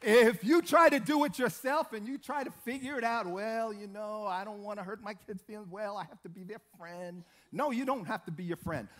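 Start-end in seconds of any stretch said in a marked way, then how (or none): 3.3-7.2 soft, hesitant mock parent voice; meek lenient parent. Preacher imitating parents who want to be friends, meek and soft. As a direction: mimic a parent afraid to be firm, much softer.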